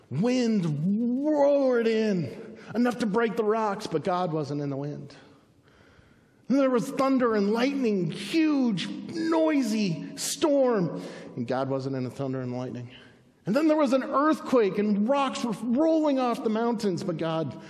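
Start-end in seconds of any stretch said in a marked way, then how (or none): 0.1-3.8 high-pitched, stretched storm telling; dramatic storytelling. A narrator's reading. 6.5-11.0 high, excited storm description; raised pitch, stretched for drama; dramatic storytelling. A narrator's reading. Dramatizing thunder and lightning. 13.4-17.0 high-pitched, urgent narration; raised-pitch preacher drama; dramatic storytelling. A narrator's reading. Dramatizing the earthquake.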